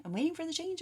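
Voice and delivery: high sing-song mocking whine; mock impatient querent. Reader mimics Aquarius waiting for the change, high mocking sing-song. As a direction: tease the client, voice lifted and far off.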